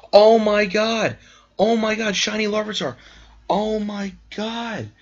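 overwhelmed with shocked joy; stunned excitement. Real, not acted. Reacting to shiny Larvitar.

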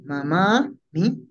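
"mamá mi" slowed, with a long final a in mamá; slow "mamá mi", vowel held before mi; stretched A. Shown as a demonstration. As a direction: draw out maa, then add "mi". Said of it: "Estiras la A".

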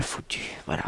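whispered swear word, held back. Real, not acted. The presenter whispering the swear word he regrets. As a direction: hush the rude word. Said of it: "je censure".

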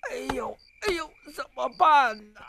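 groaning whimpers, hammed up; a touch of pained moaning.

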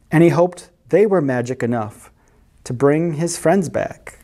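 usual speaking voice

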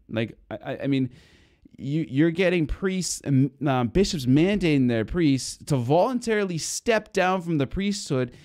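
fiery sermon rant, pitch soaring; preaching at full force. Acted. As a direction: rail against it from the pulpit, voice climbing. Heated preaching on Biden.

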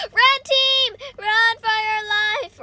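high, loud playful alarm; mock panic shout. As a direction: call out a warning in play panic. Mock panic at enemy team.

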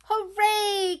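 high, loud frog cheer; lightly voiced frog cheering; croaking frog. A narrator's reading. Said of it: "croaked Frog".

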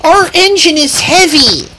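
high cry of alarm. Acted. Freight cars to each other, crying out. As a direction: call it out in dismay. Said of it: "cried the freight cars".

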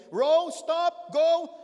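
loud, high shouted orders; captain's commands. Acted. Captain commanding the rowers under the boat, loud shouted orders. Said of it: "whatever the captain says to them".

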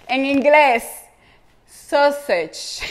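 sausage said clearly the English way; repeat-after-me model of sausage; English pronunciation. Shown as a demonstration. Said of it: "Repite después de mí".

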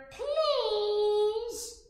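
very high small plea; tiny creature voice. Acted. Baby animals beg the bear, tiny pleading voice. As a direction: squeak it like a little animal begging. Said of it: "and just then you hear".

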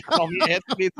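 laughing. Retold or imitated. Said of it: "You're like".

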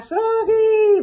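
high, loud call across the house. Acted. Dad calling Froggy to ride, loud high shout. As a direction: holler his name to hurry him. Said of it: "su papá grita".

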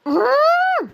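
high ghostly cackle; short wicked ghost laugh; evil cackle. Acted. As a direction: laugh like a witch. Ghost laughs after threat.

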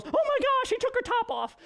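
cartoon phone squealing in shock at the laptop. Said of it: "and the phone goes".

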